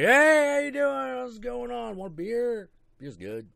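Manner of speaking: put-on high, loud, jolly drunk greeting; cheery friendly drunk. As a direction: beam like a happy pub drunk. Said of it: "He's like".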